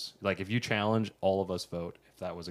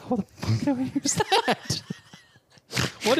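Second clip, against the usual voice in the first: shocked laugh, high and loud; 4 dB louder, slower than usual. Real, not acted. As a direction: cackle through the words. Strange noise from card.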